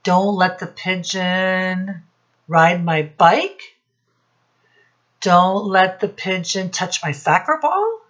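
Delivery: usual speaking voice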